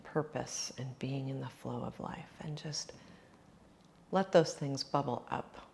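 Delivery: soft, unhurried meditation voice; guided reflection. Acted. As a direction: hush down and gently guide them inward. Leads a reflective journaling exercise.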